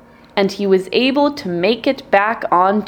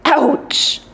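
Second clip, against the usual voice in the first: hunter yelp; 6 semitones higher than usual. A narrator's reading. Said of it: "The screaming startled the bird".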